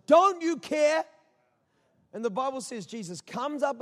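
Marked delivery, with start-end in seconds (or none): 0.1-1.0 high, loud plea to God; raised voice, demanding an answer; anguished challenge. Acted. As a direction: cry it out in protest.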